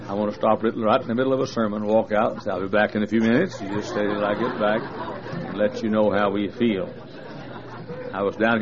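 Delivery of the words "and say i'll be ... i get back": ordinary delivery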